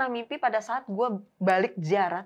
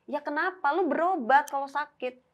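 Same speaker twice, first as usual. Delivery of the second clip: higher-pitched, impatient advice; retold exasperated scolding; 6 semitones higher than usual. Teller urges sick friend to get treatment, exasperated. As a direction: push back at a friend, pitched up.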